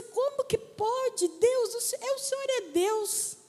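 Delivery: high, marvelling exclamation; retold astonishment. Woman exclaiming to God over the clean names, amazed.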